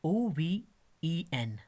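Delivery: "O V E N" spelled letter by letter, very slowly. Shown as a demonstration. Teacher spelling the word for the class, slowly. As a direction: spell out "O V E N" one letter at a time. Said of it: "और ये word है".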